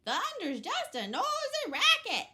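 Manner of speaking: very high, loud duck shout; loud quacking duck. Acted. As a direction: quack it as loud as you can. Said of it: "loud as he could quack it".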